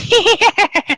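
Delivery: high, loud evil cackle; witch cackle. Acted. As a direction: cackle like a hag.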